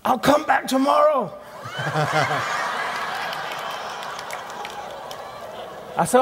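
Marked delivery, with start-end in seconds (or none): 0.0-1.3 high, loud, embarrassed outburst; embarrassed heckler retreating, mock flustered shout; flustered blurt. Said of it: "he went bright red and he went". 1.4-5.9 breathy open laugh; real laugh at his own story; preacher laughing at the memory. Real, not acted.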